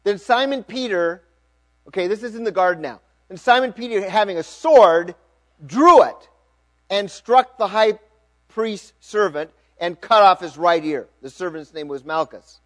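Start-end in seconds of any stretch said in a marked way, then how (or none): 0.0-1.2 higher, louder proclamation; dramatic scripture reading. A narrator's reading. Preacher opening the sword verse, dramatically projected. Reads scripture aloud to congregation. 3.3-12.6 high, loud, deliberate proclamation; dramatic scripture reading. A narrator's reading. Preacher reading of Peter drawing his sword, dramatically projected. Reads scripture aloud to congregation.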